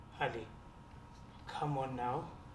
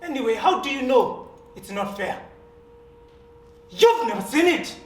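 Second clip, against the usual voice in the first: loud and high, humiliated rage; bitter fury; 5 semitones higher, 12 dB louder than usual. Acted. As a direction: throw it at him, loud. Sam bares himself, furious.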